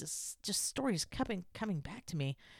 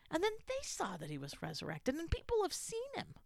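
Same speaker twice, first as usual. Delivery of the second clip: ordinary delivery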